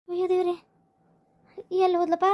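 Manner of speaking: soft, anxious muttering to herself; hushed worried sigh of an old mother. Acted. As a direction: fret under her breath at the empty room. Mother finds daughter gone at night.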